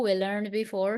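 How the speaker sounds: ordinary talk, nothing marked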